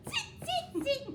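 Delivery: very high squeaky monkey voice; monkey chatter. Acted. Monkeys mock the peddler back in high squeaks. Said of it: "stamped their feet back at him and said".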